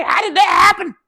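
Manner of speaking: high-pitched hammed-up shout of disbelief; mock outraged shout. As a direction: yell it up high like a stunned boss. Mock outraged boss retelling.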